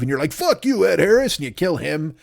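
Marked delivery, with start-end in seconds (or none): 0.3-1.4 high defiant yell at Ed Harris. Acted. Acted defiant retort.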